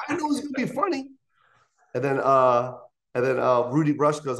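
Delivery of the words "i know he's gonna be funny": high, fast, smug aside; a quick cocky thought, pitched way up; mock inner voice. Comic imagines the host dreading his set, high and fast. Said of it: "And then I was like".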